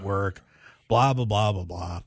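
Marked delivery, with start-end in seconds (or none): none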